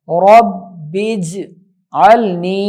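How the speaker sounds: رب اجعلنی drawn out, ra joined to ba, ba joined to jeem; slow correct recitation. Shown as a demonstration. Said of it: "را کو با کے ساتھ ملائیں گے". (آہستہ صحیح تلاوت: رب اجعلنی)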